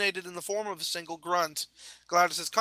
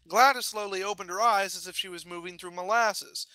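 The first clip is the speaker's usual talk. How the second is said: ordinary delivery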